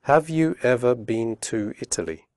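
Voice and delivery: slow and careful; careful slow question; slowly. Shown as a demonstration. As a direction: say each word clearly. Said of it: "first slowly and then quickly".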